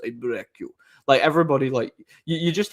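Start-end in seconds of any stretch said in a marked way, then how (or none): 0.0-0.7 put-on Ivan Drago Russian accent, a touch quieter; lightly mock Russian tough guy voice; softly imitating a Russian accent. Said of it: "and they talk like this".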